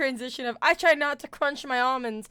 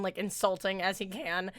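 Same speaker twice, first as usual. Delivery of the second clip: usual speaking voice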